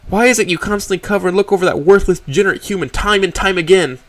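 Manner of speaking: high, loud furious snarl; angry growl. Acted. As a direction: spit it with contempt. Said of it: "I growl".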